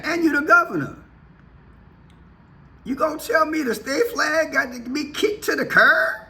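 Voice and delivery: ordinary talk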